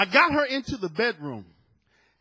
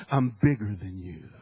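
low mock-threatening voice; mock tough husband; 11 semitones lower than usual. Husband blocking his wife at the door, low mock threat. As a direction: drop the voice low to assert yourself.

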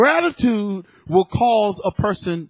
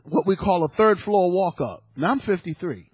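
ordinary talk, nothing marked

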